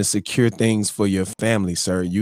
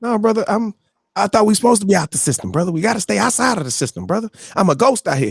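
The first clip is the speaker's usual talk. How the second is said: high, fast, disbelieving objection; incredulous pushback, pitched up and rushed; baffled protest; 10 semitones higher, faster than usual. Retold or imitated. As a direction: argue like he's caught out. Retold baffled protest to the banker.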